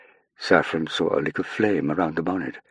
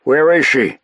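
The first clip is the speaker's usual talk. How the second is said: high, loud urgent shout; 10 semitones higher, 9 dB louder, slower than usual. Acted. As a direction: call it out bursting through the door. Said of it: "an American voice shout".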